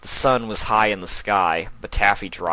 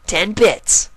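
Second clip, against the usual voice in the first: higher colt voice, firm fall; colt confirming; 12 semitones higher, 6 dB louder than usual. Acted. Colt confirming the bet to his sister, higher and firm. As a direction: settle it flatly.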